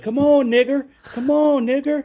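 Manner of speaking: high-pitched mock southern accent. Driver taunting from his car window, mock southern accent. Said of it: "put on a southern accent".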